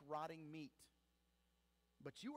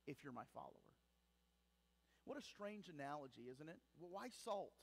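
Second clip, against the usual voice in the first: normal talking voice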